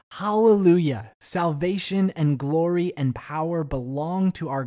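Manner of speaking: a high, loud, ringing hallelujah; loud cry. Acted. Preacher reading scripture, voicing the multitude's loud joyful cry. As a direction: shout it out like a great crowd in heaven. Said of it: "the loud voice of a great multitude".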